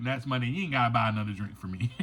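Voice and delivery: chuckling through the words, quick and low; tossing off a joke while laughing. Real, not acted. Host teasing listeners who buy drinks, laughing quickly. Joking about coffee shop drinks.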